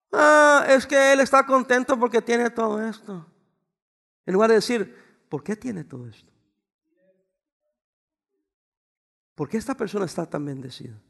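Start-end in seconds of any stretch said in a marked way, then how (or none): 0.1-3.3 imitating a jealous onlooker in a whiny singsong; mock envious grumble. 5.3-11.0 soft, lowered reflective question; quiet wondering. Retold or imitated. Models the question people should ask.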